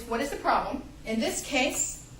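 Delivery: ordinary talk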